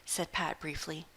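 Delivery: ordinary delivery, nothing marked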